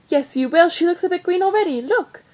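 teasing taunt. A narrator's reading. Brother taunts his seasick sister.

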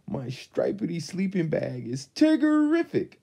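high, bouncy Tigger voice. A narrator's reading. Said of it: "Tigger exclaimed".

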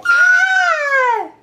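very high playful shriek; mock scream. Mother finding Elliot in peekaboo, mock scream. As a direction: shriek in pretend fright. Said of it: "You're so scary".

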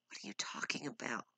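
stunned fast whisper. Acted. Husband stunned by his wife's words, whispering. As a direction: breathe the question out.

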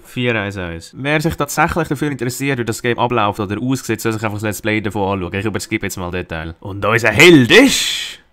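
normal talking voice

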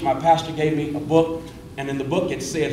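ordinary delivery

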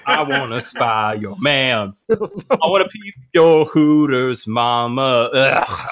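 ordinary talk

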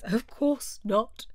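slow, drawn-out turtle voice; Mock Turtle voice. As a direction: say it with lofty certainty.

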